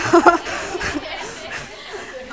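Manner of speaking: short airy laugh; real amused chuckle; breathy laugh. Real, not acted. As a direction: laugh it off lightly. Laughs at own joke.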